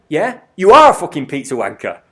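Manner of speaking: loud, emphatic imitation of a hostile dad; aggressive retort. Dad backing his son against the delivery rider, loudly hostile. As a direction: throw the insult back loudly. Dad backs up son's insult.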